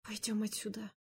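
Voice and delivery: soft muffled murmur into a chest; face in someone's chest. Acted. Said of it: "пробормотала я ему в грудь".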